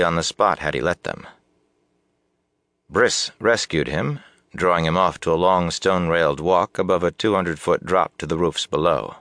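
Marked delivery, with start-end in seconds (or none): none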